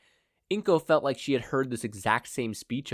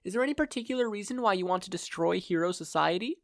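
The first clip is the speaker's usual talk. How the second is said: much higher, sweetly polite; polite captive mother; 9 semitones higher than usual. Acted. Blindfolded mother politely interrupts her kidnapper. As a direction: interrupt with mock courtesy.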